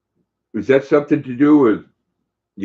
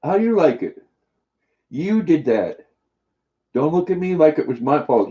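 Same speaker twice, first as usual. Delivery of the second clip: higher-pitched mock reproach to a pet; mock scolding; 5 semitones higher than usual. Owner telling Luna it was not their fault, mock scolding. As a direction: playfully blame the pet.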